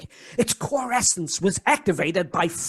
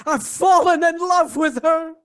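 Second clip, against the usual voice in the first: lovestruck outburst; 6 semitones higher than usual. Acted. Professor suddenly declaring love, lovestruck outburst.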